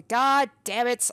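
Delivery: loud, drawn-out curse; real frustration. Real, not acted. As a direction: swear slowly and loudly in dismay. Feared death is confirmed.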